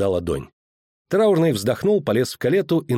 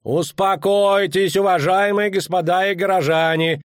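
high, drawn-out official call; 7 semitones higher than usual. A narrator's reading. Official to the townspeople before reading, calling out. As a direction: call a crowd to order in a high voice. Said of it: "крикнул траурный".

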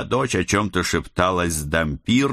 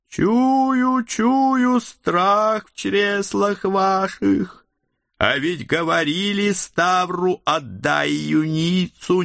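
menacing necromancer; 11 semitones higher, slower than usual. Acted. Некромант пугает гостей архаичной речью.

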